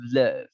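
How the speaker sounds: LOVE as "luhv", heavy V at the end; short "uh" in LOVE, then a thick V. Shown as a demonstration. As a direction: press the V closing LOVE. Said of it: "V-nya tebal".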